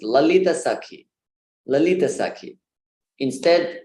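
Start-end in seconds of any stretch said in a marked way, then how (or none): 0.0-2.6 Lalita Sakhi with Lalita stressed as la-LEE-ta; mispronounced Lalita. Shown as a demonstration. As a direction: stress the middle of Lalita, keep its last vowel short. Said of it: "they all pronounce it".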